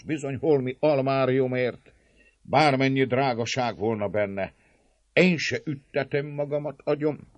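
high, insistent self-justification; consoling himself. A narrator's reading. Servant leader excusing surrender to himself, self-consoling. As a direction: talk yourself out of the fight. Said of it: "így vigasztalá magát".

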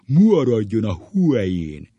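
slow, loud schoolmaster command; hammed-up teacher impression; booming teacher voice. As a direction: bellow it with swollen, drawn-out vowels. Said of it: "a sárgaság hangon".

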